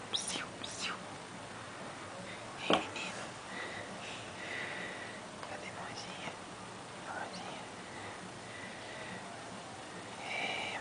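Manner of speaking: barely voiced hush to an infant; soft whispered cooing. Real, not acted. Father hushing baby, whispering.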